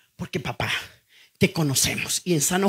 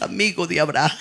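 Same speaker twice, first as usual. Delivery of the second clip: ordinary talk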